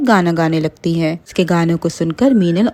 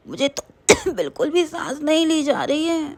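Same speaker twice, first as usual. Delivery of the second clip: high, soft, gasping between coughs; breathless and coughing; 9 semitones higher, 7 dB quieter than usual. Acted. Asthmatic Mona suffocating in smoke, soft and gasping. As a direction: barely get the words out for coughing. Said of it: "Mona जोर जोर से खांसने लगी".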